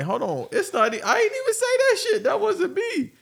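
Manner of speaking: high, laughing denial; high protesting voice. Retold or imitated. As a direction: squeak out an innocent denial. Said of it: "and I'm like".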